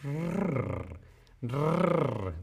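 soft imitated cat purr, rolled rrr. Shown as a demonstration. Teacher imitating her purring cat, softly. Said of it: "Mi gatito ronronea".

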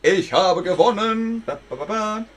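loud high triumphant cheer; victory cheer. Acted. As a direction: celebrate winning with a fanfare. Celebrating a win.

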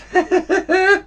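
high-pitched burst of laughing; rapid staccato giggle; laughter. Shown as a demonstration. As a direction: laugh in a quick high cackle. Laughs after the right answer.

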